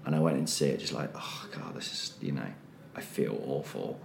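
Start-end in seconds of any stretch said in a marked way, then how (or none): 1.1-4.0 barely voiced, much softer, rough morning groan; groggy hungover mumble. Hungover at an eleven o'clock show, groaning to himself. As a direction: mumble it like a bad hangover.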